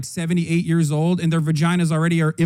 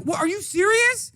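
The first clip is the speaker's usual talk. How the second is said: high, disbelieving squeak of outrage; a stunned, pitched-up what-are-you-serious; incredulous; 12 semitones higher than usual. Retold or imitated. Streamer reacting to the news in high, stunned disbelief. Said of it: "I'm like".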